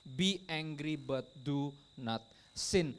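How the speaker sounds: slow, measured word-by-word reading; deliberate preacher's quotation; slow quoted verse. Retold or imitated. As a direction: spell out the verse slowly, word by word. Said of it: "Bahasa Inggris tulis gini".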